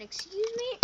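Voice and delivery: high, rising mock offence; put-on indignant query; affronted question. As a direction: lift it in disbelief. Offended after being punched.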